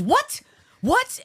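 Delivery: husband hearing his friend's dad died, stunned; shocked disbelief. Retold or imitated. Husband learns of a death, shocked high "What?". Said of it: "he goes".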